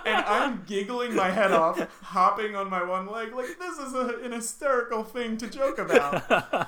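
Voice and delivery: high, loud, giggly storytelling; helpless laughter pushing the voice up; laughing hard while talking. Real, not acted. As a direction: crack up as you tell it.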